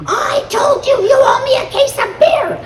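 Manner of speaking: loud, high gleeful yell; shouting to win a bet; yelling across the terminal. Retold or imitated. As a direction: call out triumphantly across the room to his buddies. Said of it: "And he yells across here".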